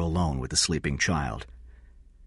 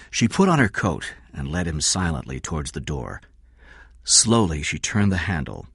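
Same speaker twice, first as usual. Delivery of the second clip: ordinary delivery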